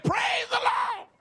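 very high, slow shouted praise the Lord. Retold or imitated. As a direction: sing it out as she is dragged off. Dragged out, still shouting praise.

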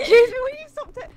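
high, loud exasperated protest; exasperated shout. Real, not acted. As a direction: shout it out, fed up. Baby keeps sabotaging her.

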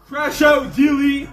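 very high drawn-out yell; yelled catchphrase. Real, not acted. Streamer announcing his crash out, high yell. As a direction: holler it out at a soaring pitch.

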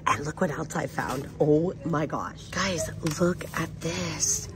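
usual speaking voice

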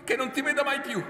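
very high, loud desperate cries; struggling shout. A narrator's reading. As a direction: scream at him to let go.

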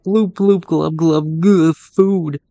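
high, loud gurgling fish voice; fish monster voice. Pretend. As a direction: burble like a hungry fish. Voicing the fish boss.

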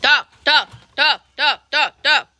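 short repeated animal call; chamando os porcos. Real, not acted. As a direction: call the pigs over in evenly repeated bursts. Calling the pigs with tomatoes. (chamado curto e repetido para os porcos)

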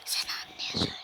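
soft unvoiced whisper. Real, not acted. Boy whispering to his little brother, hushed. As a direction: whisper to your brother away from the mic.

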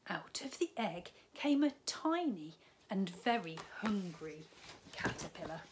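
lively storybook read-aloud; bright children's story narration. A narrator's reading. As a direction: tell it like a bedtime story to little ones. Reads picture book to children.

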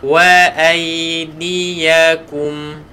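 Wa aidiyakum drawn out, ya held still; teacher modelling Wa aidiyakum; slow recitation. Shown as a demonstration. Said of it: "kena ku".

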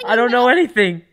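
laughing. Real, not acted. Teased by friends, laughing.

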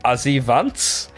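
put-on slow, halting German-accented "Ozzy Vance?" with a questioning rise; son's German accent. Dad imitating his small son asking for something, mild German accent. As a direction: lightly mimic a little boy's German-sounding accent. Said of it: "this weird German accent".

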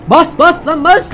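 high frantic shouting; real panic yell; panicked yell. Real, not acted. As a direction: scream at yourself to hit the gas. Panicked yelling while fleeing.

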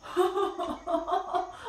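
soft amused chuckle; a quiet laugh to himself; chuckling to himself. Acted. Gruffalo chuckling to himself at the mouse's boast. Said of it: "ridendo tra sé".

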